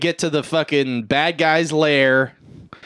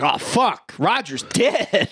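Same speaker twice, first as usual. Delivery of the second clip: higher, slow stunned realization; hammed-up dismay, pitched up and slow; mock dismay; 6 semitones higher, much slower than usual. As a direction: drawl out the shock of finding the body. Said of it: "And you're like".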